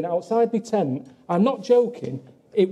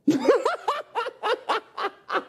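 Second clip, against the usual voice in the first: shrieking burst of laughter; high-pitched cackle; 4 dB quieter than usual. Real, not acted. Laughing at his remark.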